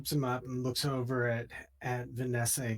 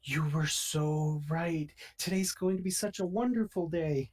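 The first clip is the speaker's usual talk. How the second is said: gushing; 5 semitones higher, 3 dB louder than usual. Acted. Ivy gushing to Shazara.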